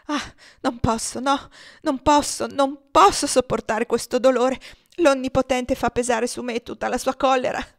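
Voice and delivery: loud, high anguished protest; agitated outcry. A narrator's reading. Father crushed by grief for his daughter, agitated and bitter. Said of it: "in preda a una viva agitazione esclamò".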